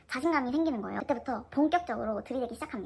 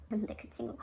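gasp; 2 semitones lower, 5 dB quieter than usual. Retold or imitated. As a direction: gasp of surprise before speaking. Friend reacts to perfume discovery. (헉 하고 놀라는 숨소리)

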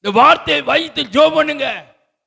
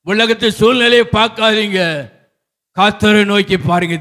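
ordinary talk, nothing marked

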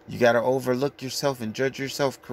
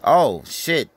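normal talking voice

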